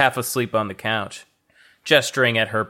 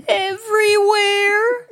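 much higher whine, stress on wear; whiny high Thea voice; 3 dB louder, slower than usual. Acted. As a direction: whine it like a sulky child. Thea character voice continues.

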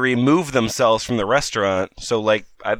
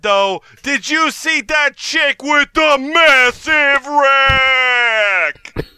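loud, high, slow boorish yelling; rowdy jeering shout; 12 semitones higher, 5 dB louder, slower than usual. Acted. Rowdy customer jeering loudly at the cosplayer. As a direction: bellow like an obnoxious drunk.

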